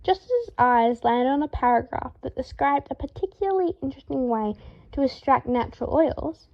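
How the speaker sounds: ordinary delivery, nothing marked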